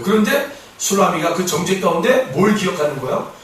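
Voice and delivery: normal talking voice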